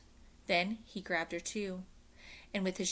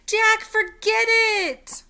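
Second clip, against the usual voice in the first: pleading shout; 14 dB louder, much slower than usual. Acted.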